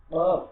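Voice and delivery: কফ loud, with a thick k from the throat; heavy throaty qaf. Shown as a demonstration. Teacher demonstrates কফ, loud, thick and from the throat. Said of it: "গলা থেকে মোটা ক দিয়ে".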